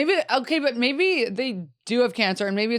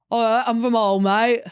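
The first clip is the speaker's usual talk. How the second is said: loud imitation of a Hull accent; 4 semitones higher, 6 dB louder than usual. Imitating an ex from Hull, exaggerated Hull accent. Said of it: "he talked like that".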